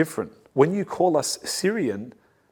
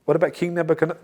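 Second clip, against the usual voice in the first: ordinary delivery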